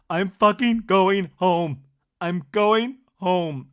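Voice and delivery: loud, slow, every word hammered; hammed-up angry announcement; storming-out outburst. Acted storming out of dinner.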